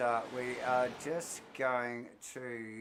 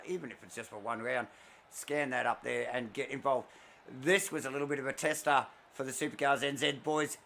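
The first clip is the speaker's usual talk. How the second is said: ordinary delivery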